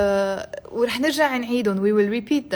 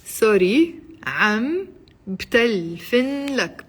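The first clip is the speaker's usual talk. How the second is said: very slow, broken into separate words; slowly. Shown as a demonstration. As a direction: spell it out by ear, pausing between words. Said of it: "I'll say it slowly".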